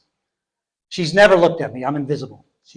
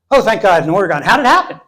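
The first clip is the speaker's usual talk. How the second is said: higher and louder relief, then eager question; relieved then eager; 4 semitones higher, 7 dB louder, faster than usual. Retold or imitated. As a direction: sigh in relief then lean in. Cashier relieved then curious.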